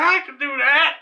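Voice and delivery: high, loud imitation of a cocky reply; eager boast. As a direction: jump at the dare. Kyle eagerly accepts the dare.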